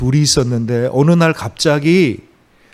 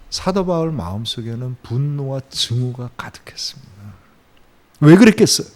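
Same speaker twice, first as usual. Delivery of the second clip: usual speaking voice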